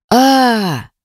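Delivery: high pained yelp; sharp cry of pain. A narrator's reading. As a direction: cry out as the cheek is scratched. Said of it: "Последний крик".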